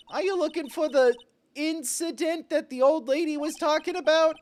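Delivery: put-on high, curious child voice; little girl voice. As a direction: ask it like an eager little girl. Voicing Pearl asking.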